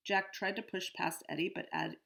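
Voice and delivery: usual speaking voice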